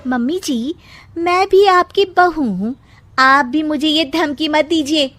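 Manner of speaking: high, loud cheeky threat; defiant bride. Acted. Bride threatens mother-in-law with lawyer father, high defiant voice. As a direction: threaten her back with a smirk.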